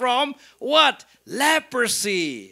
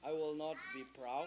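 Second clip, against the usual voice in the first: very soft, low retold reply; humbled reply; 7 semitones lower, 21 dB quieter, faster than usual. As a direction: say it small and meek, barely voiced. Humbled Naaman's reply, for repeating.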